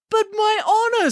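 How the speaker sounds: high, wailing protest; a hero clinging to honour; high plaintive cry; dismayed Hercules. Acted. Hercules objects.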